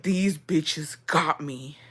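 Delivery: slow, drawn-out whisper of disbelief; hushed, stretched-out retold outrage; mad whisper. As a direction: whisper it slowly, acting out being mad. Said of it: "I was so mad".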